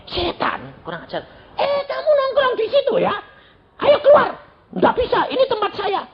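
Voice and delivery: high, mocking devil voice cut by the preacher's rebuke; squeaky high imp talking back; devil and preacher quarrel. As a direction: voice the petty devil defending his spot. Preacher confronts devil in story.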